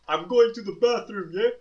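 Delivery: high, loud hammed-up shout; mock yell. Intruder announces bathroom trip, high loud mock shout. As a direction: call it out across the house.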